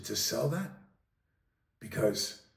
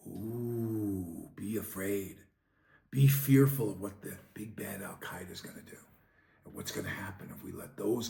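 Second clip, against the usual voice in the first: sarcastic, softer spooky whisper; mock spooky warning; 6 semitones lower, 5 dB quieter than usual. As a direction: pretend to scare them, dripping sarcasm. Mocking government fearmongering.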